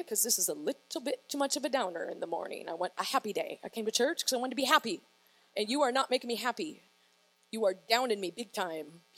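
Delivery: low, soft mock inner monologue; private grumbling. Preacher voicing a churchgoer tempted to sneak out, quiet low grumble.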